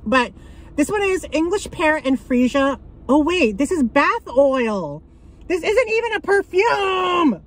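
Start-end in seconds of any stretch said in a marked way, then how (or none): 5.5-7.4 realizing she overpaid, a shocked high outcry; shocked outrage. Real, not acted. Discovers it is bath oil.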